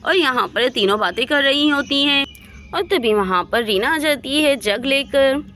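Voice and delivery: usual speaking voice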